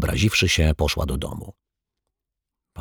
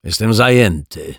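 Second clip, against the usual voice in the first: growled; 4 semitones higher, 3 dB louder than usual. Acted. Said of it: "warknął".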